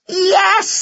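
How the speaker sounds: high, long cheer; excited shout. A narrator's reading. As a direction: shout it high like delighted children. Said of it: "they shouted".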